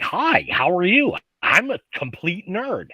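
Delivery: exaggerated nerd greeting, pitch swooping up; self-mocking nerd voice. Panelist joking about own voice, hammed-up nerd voice. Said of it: "little punk ass voice that's going on".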